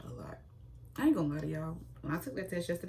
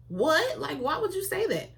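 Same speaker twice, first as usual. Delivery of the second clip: high, loud incredulous what; shocked disbelief; 12 semitones higher, 8 dB louder than usual. Retold or imitated. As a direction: squeal it out like a stunned sister. Reacting to sister's remark.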